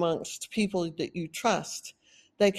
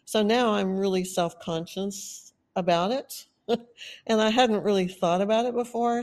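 ordinary talk, nothing marked

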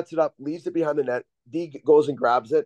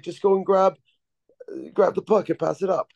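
put-on higher-pitched, clumsy French accent; bad French accent; 5 semitones higher than usual. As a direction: mimic a French-speaking coach, badly. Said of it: "that was the worst French accent ever".

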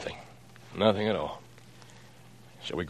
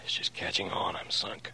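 urgent hushed whisper; whispered aside; 4 semitones lower, 11 dB quieter than usual. Acted. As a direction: whisper to your accomplice so the caller can't hear. Secret aside away from the phone.

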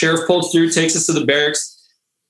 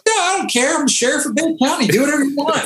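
high, cocky sheriff voice; boastful sheriff; 11 semitones higher than usual. Retold or imitated. Sheriff tells drinking sailors he's the law, cocky and high. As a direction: play the swaggering sheriff, fast.

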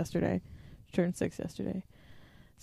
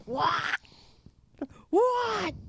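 imitating Andrew; 11 semitones higher than usual. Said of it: "Andrew's like".

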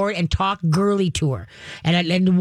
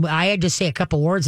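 normal talking voice

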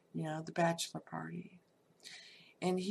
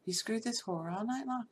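ordinary talk

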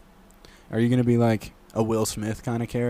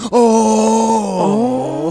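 high, loud startled oh; shocked cry; 9 semitones higher, 9 dB louder than usual. Acted. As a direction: gasp out the realization. Son's shocked reaction acted.

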